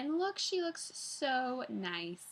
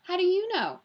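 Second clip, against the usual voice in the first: put-on high, loud puzzled child; lightly voiced baffled child; borrowed boy's voice; 10 semitones higher, 7 dB louder than usual. A narrator's reading. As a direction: ask it raised, in another's voice. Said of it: "although she sounded like Paul".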